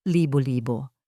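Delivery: plain reading voice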